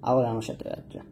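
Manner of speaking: ordinary delivery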